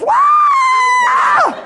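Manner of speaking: very high, loud drawn-out wail; huge comic shrieked "wah"; heart bursting noise. Retold or imitated. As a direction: imitate a heart exploding with a long shriek. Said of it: "their heart goes".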